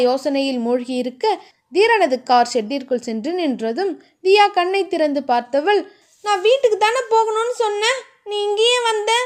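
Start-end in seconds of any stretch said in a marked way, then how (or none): 6.2-9.1 higher, angry protest. A narrator's reading. Diya confronting Dheeran for bringing her to the resort, angry and higher. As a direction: snap at him, voice pitched up in anger. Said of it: "கோபமாக தியா கேட்க".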